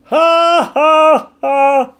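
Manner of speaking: loud, very high staged laugh; a big theatrical ha ha ha; hammed-up high laugh; mock cackle. Mock laugh after joke.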